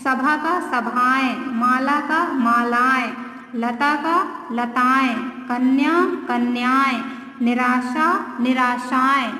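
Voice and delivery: slow, clear word pairs for students; slow clear drill. Shown as a demonstration. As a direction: read each pair slowly, like a drill. (धीमे, साफ़ उच्चारण में शब्द जोड़े)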